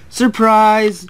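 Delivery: high, loud yell. A narrator's reading. Two engines jump out to scare Mac, yelling. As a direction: yell it out to startle. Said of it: "yelled Duncan and Billy together".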